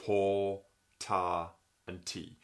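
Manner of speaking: to ta ti spoken as separate syllables; tonguing vowel demo. Shown as a demonstration. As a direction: say to ta ti one at a time. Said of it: "So you've got".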